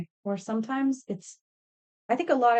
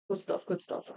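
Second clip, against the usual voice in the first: quieter voiceless repeat of "Good stuff"; muttered approval; 8 dB quieter than usual. Real, not acted. Crafter praising her own work under her breath. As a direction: murmur "Good stuff, good stuff" to herself. Muttering to herself while working.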